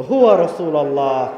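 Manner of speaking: normal talking voice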